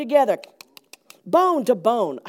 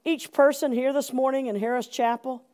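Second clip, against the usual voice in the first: soft, subdued pastoral prayer; 3 semitones lower, 6 dB quieter than usual. Real, not acted. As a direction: pray quietly, letting the line endings sink. Leads the congregation in prayer.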